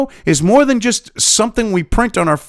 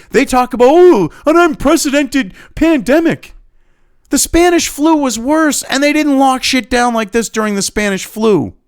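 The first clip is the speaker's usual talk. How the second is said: louder, higher, slowed heated rant; angry rant; 7 semitones higher, 4 dB louder than usual. Real, not acted. Vlogger ranting at the health board, raised angry voice. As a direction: rage at the lockdown, hammering each phrase.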